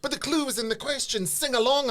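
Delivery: mock exasperation. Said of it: "He went".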